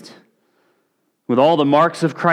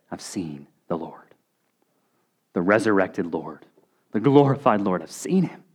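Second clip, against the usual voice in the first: hushed, breathless wonder, much softer; awed whisper; 7 semitones lower, 6 dB quieter than usual. Acted. Mary tells of seeing the risen Lord, awed whisper. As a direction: whisper it like a holy secret. Mary's awed first testimony.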